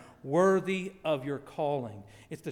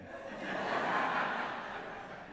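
laughter; 5 dB quieter than usual. Real, not acted. Laughs at own joke.

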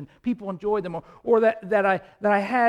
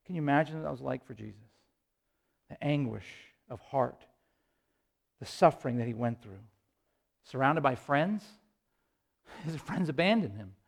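low, soft and solemn; hushed, sorrowful reflection; 8 semitones lower, 9 dB quieter than usual. Real, not acted. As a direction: drop low and soft to dwell on the suffering. Preacher dwells on Jesus' anguish.

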